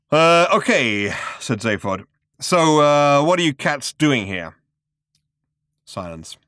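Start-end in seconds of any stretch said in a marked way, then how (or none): 0.1-4.5 nervous loud bluster. Acted. Nervous Zaphod breaking silence.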